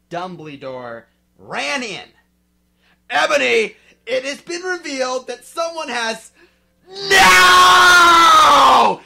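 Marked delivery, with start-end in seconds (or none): 3.1-6.3 urgent announcement. Acted. 6.9-9.0 high, very loud scream; shout. Acted. Dumbledore shouting in alarm, full scream. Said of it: "he shouted".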